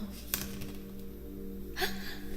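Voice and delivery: soft, sharp intake of breath; astonished gasp. Real, not acted. Streamer sees a lucky roll for viewer, soft gasp. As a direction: gasp at amazing luck.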